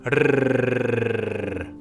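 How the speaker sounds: long loud rolled r trill; tongue trill. Shown as a demonstration. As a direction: hold a slow tongue trill. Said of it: "함께 혀 끝을 떨어볼까요?".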